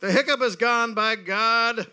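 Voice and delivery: mock grand declaration; quoted triumphant drunk. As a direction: proclaim victory like a blustering old gentleman.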